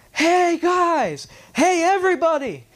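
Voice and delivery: high, loud urgent calling; a new convert calls to strangers, high urgent shout. Retold or imitated. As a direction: call out to the group with urgency. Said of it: "he has this urgency in his voice".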